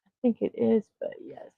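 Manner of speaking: usual speaking voice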